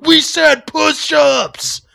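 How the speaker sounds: drawn-out threatening bark; imitated inmate yell. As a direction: inmates yelling at the kid on the floor. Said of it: "They're like".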